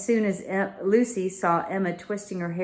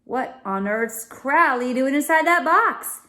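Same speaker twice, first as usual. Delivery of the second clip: put-on higher, louder astonished girl; exclaiming; 7 semitones higher, 5 dB louder than usual. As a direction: blurt out in amazement. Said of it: "exclaimed Lucy".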